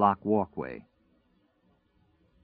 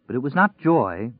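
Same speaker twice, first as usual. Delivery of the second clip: ordinary delivery, nothing marked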